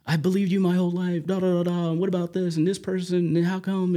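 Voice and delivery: high, fast, angry outburst; letting God have it. Retold or imitated. Finally venting anger at God in prayer, high fast outburst. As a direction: pour out years of anger at once. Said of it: "And I let him have it".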